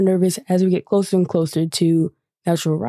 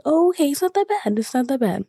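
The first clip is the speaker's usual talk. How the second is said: self-reassuring; 7 semitones higher than usual. Retold or imitated. Retold self-reassurance as ride starts.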